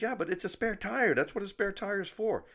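high, insistent argument; retold indignant objection; exasperated protest. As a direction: argue back with pitch jumping up. Arguing back with the clerk.

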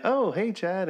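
put-on high, cheerful old uncle greeting; a light take on the uncle's surprised hello; imitating uncle.

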